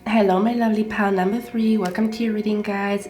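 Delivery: ordinary talk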